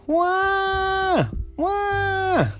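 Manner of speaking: high, drawn-out mock "wah"; hammed-up little boy's bawl; crying wail. Boy going home upset about school, hammed-up crying wail. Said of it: "He went home crying".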